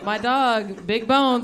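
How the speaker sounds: ordinary talk, nothing marked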